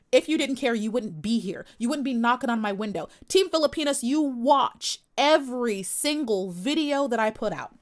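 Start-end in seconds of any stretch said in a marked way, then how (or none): none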